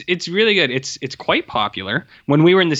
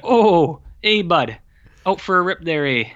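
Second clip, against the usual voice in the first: higher, friendly Canadian hoser greeting; Canadian hoser accent; 5 semitones higher, 3 dB louder than usual. Retold or imitated. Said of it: "Why are you talking like that?".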